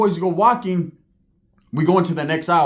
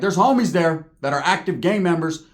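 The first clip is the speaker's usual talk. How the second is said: normal talking voice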